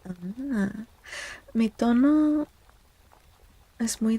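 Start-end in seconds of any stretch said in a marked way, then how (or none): none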